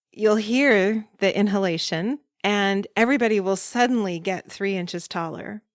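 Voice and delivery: normal talking voice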